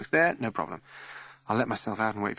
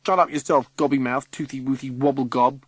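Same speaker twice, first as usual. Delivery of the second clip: 1 cheeky retort; 4 semitones higher, 6 dB louder than usual. Acted. Kids trading insults.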